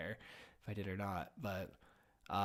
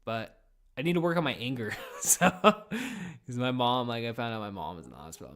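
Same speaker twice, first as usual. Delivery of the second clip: ordinary talk, nothing marked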